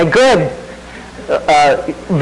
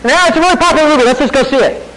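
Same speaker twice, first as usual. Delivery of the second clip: higher, fast, breezy careless parent; a mock thoughtless father brushing it off, higher and quick; careless dad; 7 semitones higher, faster than usual. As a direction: toss it off without a thought. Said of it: "threw Harry Potter at my kids and said".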